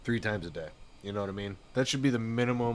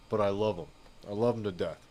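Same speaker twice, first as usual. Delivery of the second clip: normal talking voice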